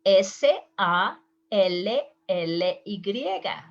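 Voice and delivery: S A L L Y spelled slowly, letter by letter in Spanish; slow spelling. Shown as a demonstration. Teacher slowly spells a name for the students. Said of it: "you are going to say".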